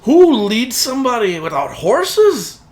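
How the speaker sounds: high, loud, exasperated scolding; incredulous driver. Acted. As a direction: scold them in sheer disbelief. Driver scolds party for lacking horses.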